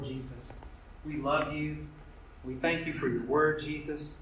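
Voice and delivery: earnest, rising prayer voice; pastoral prayer. Acted. Pastor leading his congregation in closing prayer, fervent. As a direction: pray it over the congregation with fervor.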